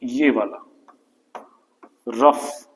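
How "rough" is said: rough said alone, lower, ending on f; rough pronunciation demo. Shown as a demonstration. Teacher showing how rough is pronounced, pronunciation demo. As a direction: model rough with its final f sound. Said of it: "इसका pronunciation होता है".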